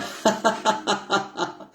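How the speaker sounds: high simulated laughter; fake laugh. Shown as a demonstration. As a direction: laugh on purpose with the listener. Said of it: "Commençons à faire des rires simulés".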